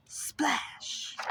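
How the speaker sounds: whispered splash. A narrator's reading. Junior falls into water.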